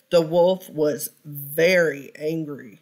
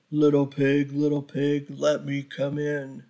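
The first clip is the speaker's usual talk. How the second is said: a lightly lowered wolf voice at the door; big bad wolf; 4 semitones lower, 3 dB quieter than usual. Wolf coaxing the little pig to open up, low wolf voice. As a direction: growl it softly as the hungry wolf.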